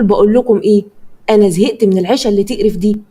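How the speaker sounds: usual speaking voice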